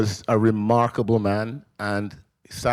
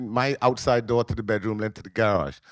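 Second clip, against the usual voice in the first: normal talking voice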